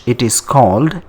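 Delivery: ordinary talk, nothing marked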